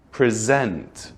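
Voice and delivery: "present" as the verb, pri-ZENT; second-syllable stress. Shown as a demonstration. Teacher saying it two ways, "present" with end stress. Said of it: "say it two ways".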